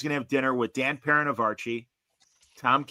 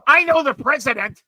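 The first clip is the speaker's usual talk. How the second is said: much higher, louder indignant brag; mock pompous boast; 13 semitones higher, 9 dB louder than usual. Guy threatens with his connections, high loud mock brag. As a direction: shout it like a trump card.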